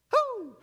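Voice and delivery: high, sudden "woo"; short high-pitched cheer; celebratory whoop. Real, not acted. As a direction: whoop with joy at good news. Preacher whoops at good news.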